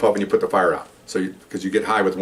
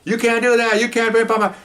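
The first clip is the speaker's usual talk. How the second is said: much higher mock yelling protest; imitating her yelling; 13 semitones higher, 3 dB louder than usual. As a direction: yell it up high at the tow driver. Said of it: "she's yelling at the tow truck driver".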